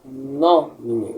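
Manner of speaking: nomine with NO stretched and stronger; stressed first syllable. Shown as a demonstration. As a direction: lean on the NO in nomine, then let mine drop. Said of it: "a sílaba tônica será".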